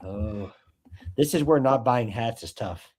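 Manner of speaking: ordinary talk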